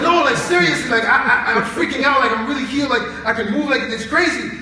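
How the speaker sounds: excited insisting. Retold or imitated. Student insists she is healed.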